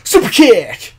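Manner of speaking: short, loud yelled catchphrase; imitating Marty Scurll. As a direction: yell it out like a wrestler's signature call. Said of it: "when he yells out".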